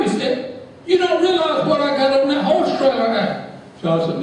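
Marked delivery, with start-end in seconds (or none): 0.9-3.5 higher, boastful put-on voice; brash cowboy. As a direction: swagger like a rich rancher.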